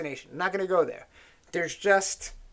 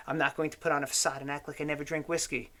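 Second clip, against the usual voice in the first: ordinary delivery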